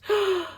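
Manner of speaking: high, short gasp of delight; thrilled intake of breath. Real, not acted. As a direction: gasp at something adorable. Gasps at how cute it is.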